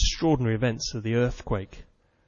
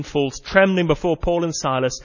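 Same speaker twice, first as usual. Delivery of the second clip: ordinary talk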